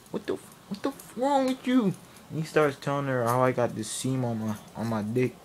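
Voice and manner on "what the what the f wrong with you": high-pitched incredulous protest; outraged. Retold or imitated. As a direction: start hushed and burst out indignant. Outraged at dad's oversharing.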